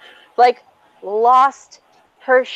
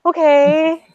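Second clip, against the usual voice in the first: slow, sheepish backing down; tentatively placate, imitating him; hesitant mock-meek voice; chastened boyfriend; 3 semitones higher, slower than usual.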